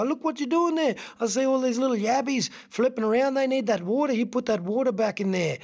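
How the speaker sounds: high-pitched, fussing emu voice; agitated emu. Acted. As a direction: scold the frog in a high, urgent voice. Emu scolds frog in story.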